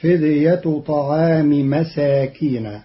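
"مساكين" plural with open final nun, slow; slow careful reading. Shown as a demonstration. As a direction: read "فدية طعام مساكين" slowly, no tanwin. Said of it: "فتصير الجملة". (قراءة متأنية لـ"مساكين" بفتح النون)

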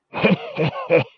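high, breathless rasping chuckle; a crazed old man's wheezing heh heh heh; hermit's unhinged rasping laugh; raspy cackle. A narrator's reading. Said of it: "rasped the man".

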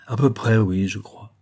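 usual speaking voice

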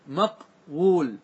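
مقول stretched very slowly as maqwul, the w sounded out; slowly drawn out. Shown as a demonstration. Teacher spelling out the original form for students, very slow. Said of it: "أصله إيش؟".

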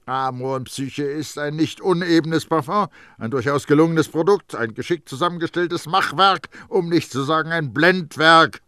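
heated storyteller voicing the perfumer; indignant character monologue. A narrator's reading. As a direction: pass a scathing verdict with rising force.

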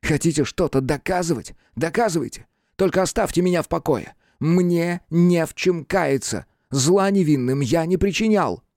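high-pitched, defiant denial; agitated protest. A narrator's reading. Cornered suspect defying the investigator, agitated and high. Accused man protests his innocence.

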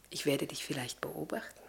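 a gentle, half-teasing whisper; whispered promise. Acted. Lavinia promising the captain to watch from heaven: whispered. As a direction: breathe it softly, almost voiceless.

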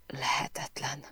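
breathy whisper of disbelief; whispered thought. A narrator's reading. Narrator thinking to herself, whispering in disbelief. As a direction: barely voice it, almost a breath.

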